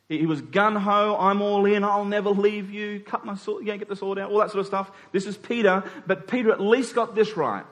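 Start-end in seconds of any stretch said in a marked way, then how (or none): none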